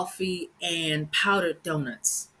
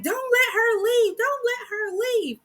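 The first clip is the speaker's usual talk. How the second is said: very high, slow, shrill-sounding mock lady; alarmed lady; slower than usual. Lady telling bystanders to stop the driver, very high and slow. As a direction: drag it out high like a panicking busybody. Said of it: "And she's like".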